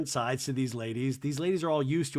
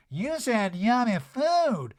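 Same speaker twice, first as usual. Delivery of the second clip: mock Erica; 8 semitones higher, 6 dB louder, much slower than usual. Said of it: "Erica said".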